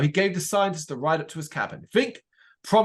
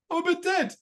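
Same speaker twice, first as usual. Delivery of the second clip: much higher, playful "I'm a bit dead"; gleefully sinister ghost. Retold or imitated. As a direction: deliver a ghoulish joke with glee. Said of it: "It's very gleefully, uh, sinister".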